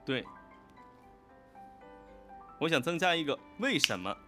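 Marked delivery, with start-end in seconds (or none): none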